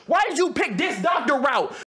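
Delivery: ordinary talk, nothing marked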